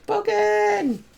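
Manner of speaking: very high, slow mock shriek; silly squeal. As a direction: drag it out in a cartoonish high squeal. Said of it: "It's like".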